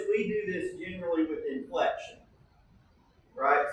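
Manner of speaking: normal talking voice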